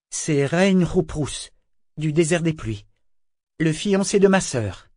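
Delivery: usual speaking voice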